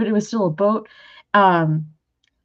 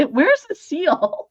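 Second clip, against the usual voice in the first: laughing question; 8 semitones higher, slower than usual. Retold or imitated. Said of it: "I'm like".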